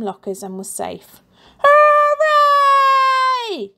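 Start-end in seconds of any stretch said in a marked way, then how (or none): 1.6-3.7 put-on high, loud kids' cheer. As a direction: cheer like a crowd of kids. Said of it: "shouted all the kids".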